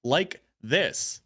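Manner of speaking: measured generalized accent without the Boston. Shown as a demonstration. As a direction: switch to a careful generalized American accent. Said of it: "you hear me shift between these two".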